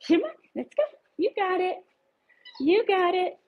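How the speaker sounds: sing-song encouragement to a nervous puppy; high dog-praise voice. Real, not acted. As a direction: cheer the puppy along like a proud owner. Coaxing the dog to walk.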